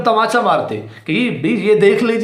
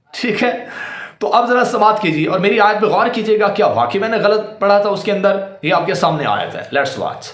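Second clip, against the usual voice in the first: ordinary delivery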